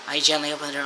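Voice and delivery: normal talking voice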